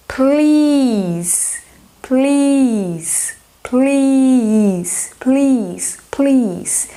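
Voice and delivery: "please" with a long drawn I, falling; teacher modelling "please" for students, long I. Shown as a demonstration. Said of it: "E com A".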